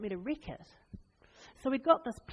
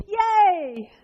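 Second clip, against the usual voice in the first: a high, loud, hammed-up yay; mock cheer; 10 semitones higher, 10 dB louder than usual. Preacher mocks cheering at the valley of death, loud and high. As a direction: burst out with fake joy.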